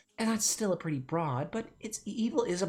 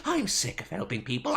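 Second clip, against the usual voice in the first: ordinary talk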